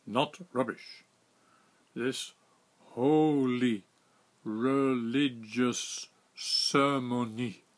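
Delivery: slow, loud, word by word, "holy" punched; careful emphasis. Retold or imitated. Captain explaining the ritual, slow emphatic broken English. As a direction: spell it out with heavy emphasis on "Not" and "holy". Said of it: "pronounced the next words carefully and with emphasis".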